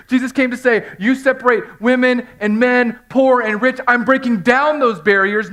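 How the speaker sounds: loud, high, ringing sermon climax; preaching at full force. Acted. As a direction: preach it out to the whole room.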